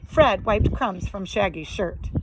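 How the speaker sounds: usual speaking voice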